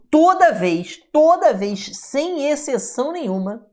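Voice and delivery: TODA VEZ punched high and loud, then repeated just as hard; emphatic insistence. Real, not acted. Teacher warning pupils there is no exception, emphatic insistence.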